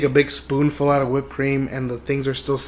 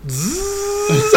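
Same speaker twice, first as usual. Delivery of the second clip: high sustained buzzing imitation; window motor buzz. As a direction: imitate an electric car window whirring. Imitates car window coming down.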